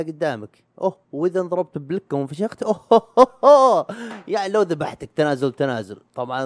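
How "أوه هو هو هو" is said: high, loud hammed-up war whoop; a mock yell as if going into a fight; mock battle cry. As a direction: let out a big playful yell.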